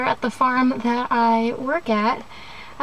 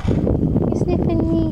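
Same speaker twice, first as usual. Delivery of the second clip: talking to sheep; 5 semitones higher, slower than usual. Real, not acted. A sheep sniffs her.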